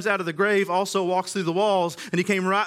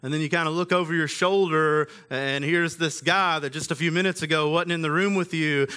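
normal talking voice

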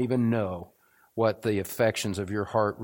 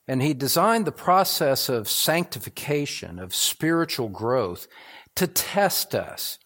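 loud, rising preacher's build; fervent preaching; 5 semitones higher, 4 dB louder than usual. Acted. Preacher to his congregation, loud and fervent. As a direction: press the point home to the church.

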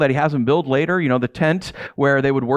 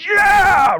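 loud, high sudden yell; fan's cheer; 13 semitones higher, 6 dB louder than usual. Retold or imitated. A sports fan cheering the winning play, with a loud high yell. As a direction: leap up and cheer the touchdown. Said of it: "you can't help but sit there and go".

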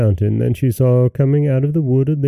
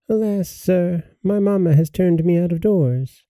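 put-on sorrowful girl; 8 semitones higher than usual. A narrator's reading. Crying girl telling the king's son, plaintive. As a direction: lament gently like a poor cast-out child. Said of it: "why she cried".

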